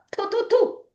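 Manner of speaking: high-pitched spitting "thu, thu, thu"; mock spitting. Shown as a demonstration. Showing listeners how to spit negatives out, mock spitting. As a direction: spit it out in three quick puffs. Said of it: "either spit it out".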